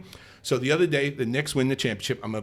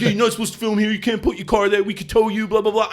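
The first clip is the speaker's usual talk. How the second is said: a higher, lightly voiced mocking cop; imitating nagging cop; 7 semitones higher, 3 dB louder than usual. Mimicking the Harbor Patrol cop nagging him, higher. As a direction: rattle off the rules like a bored officer.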